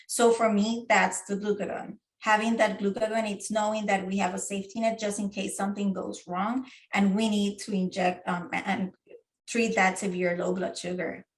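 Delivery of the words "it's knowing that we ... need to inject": ordinary delivery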